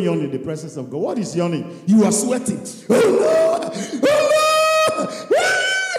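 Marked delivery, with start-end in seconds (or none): 2.9-5.9 very high, loud shouted cry; hammed-up frantic praying; shrieked prayer. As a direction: scream it to heaven. Mocking sweaty shouted prayer.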